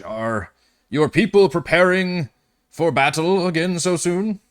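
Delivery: lightly voiced noble, questioning; a touch of a proud lord probing the newcomers; tall noble at the council. Noble asks the newcomers about battle, lightly voiced.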